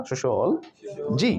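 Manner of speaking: सोशियोलॉजी slowly as soshol... ji; correct pronunciation. Shown as a demonstration. Teacher models सोशियोलॉजी slowly as soshol-ji. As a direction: hold सोशियोलॉजी to the L, with SH. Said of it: "it's social".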